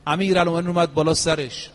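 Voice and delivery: ordinary delivery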